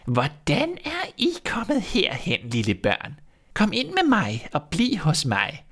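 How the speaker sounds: put-on high, slow, sweet old woman's voice; a witch sweetly luring the lost children. A narrator's reading. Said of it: "Den gamle rokkede med hovedet og sagde". (sødlig gammel heksestemme)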